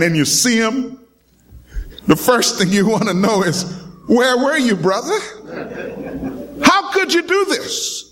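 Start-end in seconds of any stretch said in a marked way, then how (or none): 4.1-8.0 rising, pleading how could you; betrayed friend demanding answers, rising and pleading; hurt reproach. Acted.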